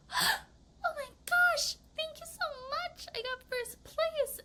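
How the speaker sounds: very high, slow, almost crying with joy; overjoyed tearful thanks. Pretend. A first-place winner thanking everyone, high and tearful with joy. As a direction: gush thanks like you just won. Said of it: "So they're like".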